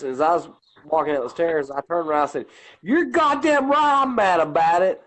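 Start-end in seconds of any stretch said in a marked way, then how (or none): 2.8-5.0 angry retort. Retold or imitated. Retold angry outburst at commissioner.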